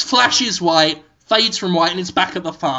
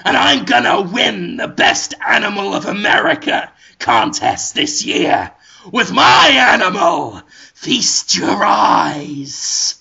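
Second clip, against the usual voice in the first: evil capitalist villain. Acted. Sludgeworth bragging to Willem Dafoe, hammed-up villain. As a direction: gloat like a cartoon tycoon. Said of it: "They're so abrasive".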